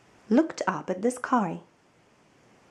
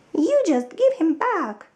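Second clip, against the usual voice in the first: put-on indignant child; 9 semitones higher, 4 dB louder than usual. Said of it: "Harry said".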